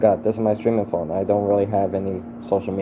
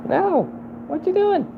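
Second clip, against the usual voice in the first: much higher pleading squeals; shrieking protest. Real, not acted. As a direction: squeal at them to stop. Alarmed at someone's actions.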